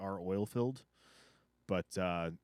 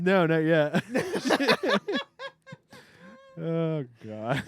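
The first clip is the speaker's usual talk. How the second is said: laughing; 8 semitones higher, 8 dB louder, much slower than usual. Real, not acted. Cracking up laughing.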